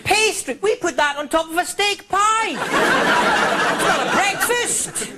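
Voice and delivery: high, hammed-up French voice; prissy Frenchman savouring his little croissant; exaggerated French accent, mocking the dainty breakfast. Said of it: "what the French have for breakfast".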